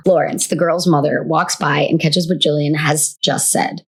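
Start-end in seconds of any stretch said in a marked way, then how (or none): none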